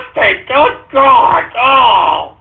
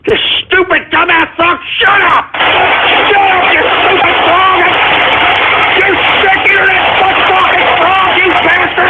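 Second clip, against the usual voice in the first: furious yelling; 9 semitones higher than usual. Acted. Host screaming at a prank caller, furious. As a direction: scream the insults at him.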